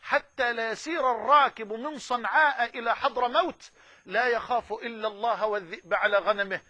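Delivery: ordinary talk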